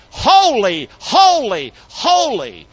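each "holy" loud and high, then falling; emphasis by repetition. Shown as a demonstration. Preacher showing what the Bible elevates, every "holy" cried high. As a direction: punch all three "holy" separately. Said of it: "when you see that in the Bible".

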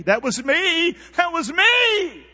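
high, building cry, last word drawn out; loud confession. Acted. Voicing congregation's confession, building.

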